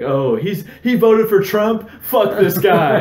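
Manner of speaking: mock partisan sneer. Mocking an imagined partisan rescuer.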